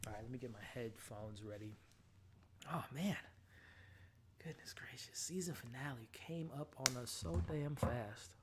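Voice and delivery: hushed, breathy whisper; nervous whispered aside. Real, not acted. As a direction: whisper it to yourself, jittery. Nervous waiting for finale.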